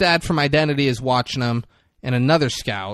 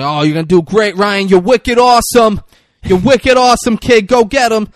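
loud, high-pitched hyped-up cheering on; shouted encouragement; 6 semitones higher, 10 dB louder than usual. Retold or imitated. Cheering Ryan on as he walks past, shouted encouragement. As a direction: holler it like a rowdy supporter.

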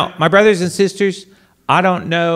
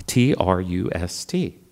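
spelled out slowly; 8 semitones lower, 6 dB quieter than usual. Shown as a demonstration. Priest spells the word trust, slow and soft.

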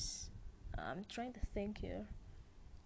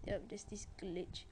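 ordinary talk, nothing marked

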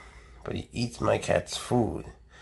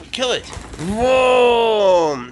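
excited pet talk; 10 semitones higher, 6 dB louder, much slower than usual. Real, not acted. Owner luring the cat to a toy snake, high excited baby talk. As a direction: get the cat hyped up.

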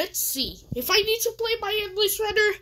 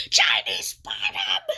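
giggly whisper; 13 semitones higher than usual. Real, not acted. Giggling at a silly name.

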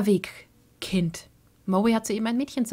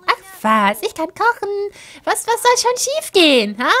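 much higher, fast chirpy wife; 10 semitones higher, 7 dB louder than usual. Acted. Wife Sim chatting to husband, high and quick.

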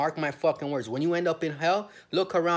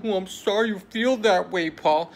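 much higher, louder prim mock voice; mocking the agent; 7 semitones higher, 8 dB louder, slower than usual. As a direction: sneer it as a fake-polite official. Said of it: "And she's like".

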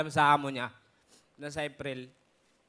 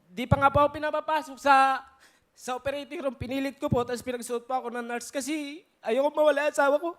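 high, loud, choked-up plea; anguished outpouring; 8 semitones higher, 8 dB louder than usual. Real, not acted. Husband recalling his wife in the operating room, anguished. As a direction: grief rising to a loud peak. Recounting wife's operation, grief peaks.

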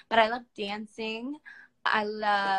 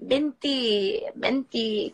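ordinary delivery

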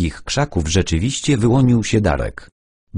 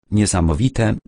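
usual speaking voice

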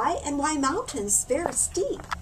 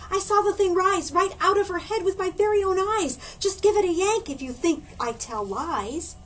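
put-on insisting boy; 8 semitones higher than usual. Butch protests to the teacher.

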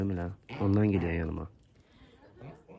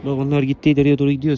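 calling out loud; 5 semitones higher, 10 dB louder than usual. Real, not acted. Telling a goose the others left.

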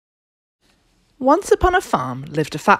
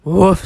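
loud woof; one big rising woof; dog's woof; 3 semitones higher, 7 dB louder than usual. A narrator's reading. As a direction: bark it out like a dog. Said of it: "said the dog".

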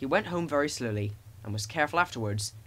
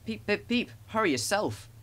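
high little whistle and retort; cheeky; 7 semitones higher than usual. A narrator's reading. Thomas answering cross Gordon, high and cheeky. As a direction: answer back pertly, pitched up. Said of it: "said cheeky Thomas".

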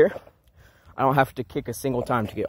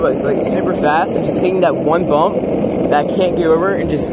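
raised voice over wind while riding; on a bumpy road; 4 semitones higher, 5 dB louder than usual. Real, not acted. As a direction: talk loudly while rolling downhill. Still riding downhill.

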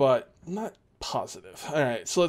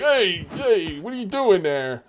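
wolf as a New York Italian tough; indignant New York Italian buddy; New York Italian accent; 2 semitones higher, slower than usual. Acted. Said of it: "speaking like, uh, New York Italian".

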